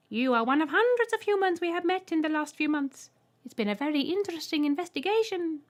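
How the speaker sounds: very high, loud piercing voice; Trembler. A narrator's reading. Alien greeting the human, piercing high voice. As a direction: squeak it out politely.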